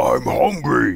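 Cookie Monster-like puppet growl; Frank Oz's deep voice. Retold or imitated. Imitating a puppeteer's monster character for the co-host, deep voice. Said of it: "Frank Oz doing his deep voice".

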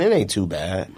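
normal talking voice

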